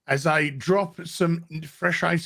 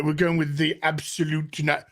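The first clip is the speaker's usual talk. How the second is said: usual speaking voice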